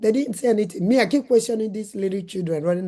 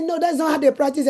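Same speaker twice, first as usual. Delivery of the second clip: high, louder mock nurse voice; mimicking a nurse; 7 semitones higher, 4 dB louder than usual. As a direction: lift the pitch and mock the nurse's firm refusal.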